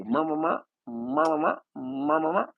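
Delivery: high, repeated creature grunts; Pokemon grunts. Retold or imitated. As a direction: chirp it like a cartoon monster. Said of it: "they go".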